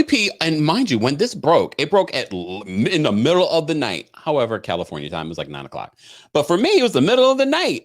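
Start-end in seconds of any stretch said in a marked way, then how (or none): 6.3-7.8 emphatic. Real, not acted. Emphatic lively complaint.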